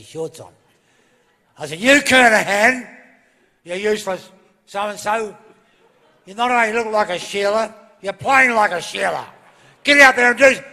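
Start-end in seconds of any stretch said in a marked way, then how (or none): 1.8-10.6 angry coach's spray at full volume; shouting. Retold or imitated. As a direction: yell it furiously at a player. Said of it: "sorry, too loud?".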